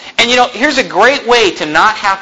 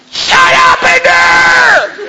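much higher, loud, dragged-out mock shout; yelling across the room; 4 dB louder, much slower than usual. Parent too lazy to get up, hollering at the kids, mock shout. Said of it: "easier to yell across the room".

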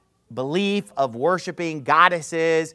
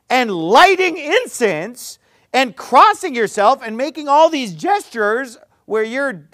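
higher, louder, sarcastic relief in a put-on voice; sarcastic mock relief; 6 semitones higher, 9 dB louder than usual. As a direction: play gullible relief and pile on the sarcasm. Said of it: "we're just supposed to go like".

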